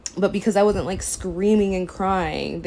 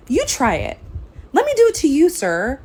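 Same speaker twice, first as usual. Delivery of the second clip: much higher and louder, challenging; indignant outburst; 9 semitones higher, 7 dB louder than usual. Retold or imitated. Angry challenge to the doctor who blamed her. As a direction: dare him to try it himself.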